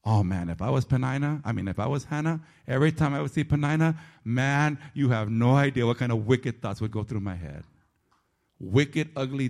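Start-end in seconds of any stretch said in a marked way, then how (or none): none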